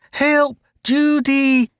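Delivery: high panicked cry; scream. A narrator's reading. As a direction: scream again for Judy. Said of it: "Jane screamed again".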